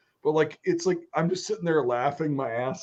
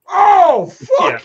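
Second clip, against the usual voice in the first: much higher, very loud shocked cry; 11 dB louder than usual. Retold or imitated. Mike seeing the clogged toilet, loud horrified outburst. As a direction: blurt it out in horror.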